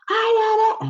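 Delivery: high mock shout of a child; imitating a child yelling. Teacher imitating a screaming student, high and loud. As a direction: yell it like a screaming kid. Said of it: "children screaming in my face".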